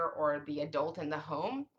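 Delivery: ordinary talk, nothing marked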